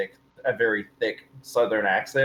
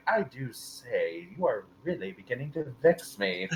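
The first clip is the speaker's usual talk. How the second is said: put-on refined English accent; 8 dB quieter than usual. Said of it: "burly redneck character from Liverpool, but the minute your mouth opens".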